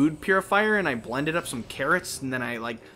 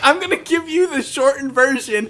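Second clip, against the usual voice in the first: high, louder mocking voice; mock imitation; 10 semitones higher, 7 dB louder than usual. Teasing a friend who reads word for word, mocking. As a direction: parody your friend's promise. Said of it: "You're like".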